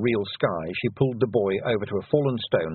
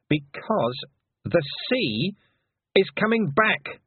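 rising alarm; 8 semitones higher, slower than usual. Acted. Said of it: "she said".

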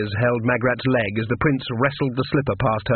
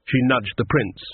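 ordinary delivery

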